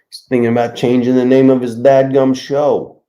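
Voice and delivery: loud mock Brooklyn accent; put-on Brooklyn voice, pushed loud. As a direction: announce it loudly, trying on Brooklyn. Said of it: "Why do you sound like you're from Brooklyn?".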